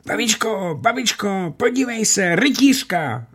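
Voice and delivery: put-on high, eager children's voices; excited children's cry. A narrator's reading. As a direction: call grandma over, thrilled by what you see. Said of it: "vykřikly děti".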